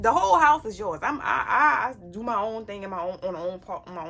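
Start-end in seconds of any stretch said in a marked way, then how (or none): 0.0-1.0 higher, louder, proclaiming it; loud declaration. Acted. Mother telling her kids the house is theirs, loud and high. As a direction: announce it big to the kids.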